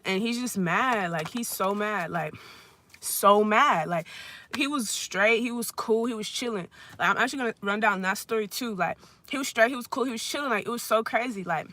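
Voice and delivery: normal talking voice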